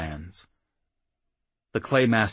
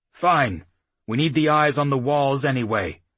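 put-on gruff old chief; 6 semitones higher, 6 dB louder than usual. A narrator's reading. Claymaster agrees curtly.

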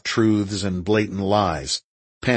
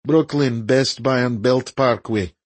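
put-on Eastern European accent, higher and slower; 4 semitones higher than usual. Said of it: "in his best Eastern European accent".

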